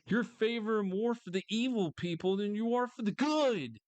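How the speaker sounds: normal talking voice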